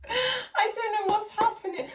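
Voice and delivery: high, helpless real laughter; giggling helplessly at the reading; laughing. Real, not acted. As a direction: laugh until the words barely come out. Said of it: "I don't know why I'm laughing".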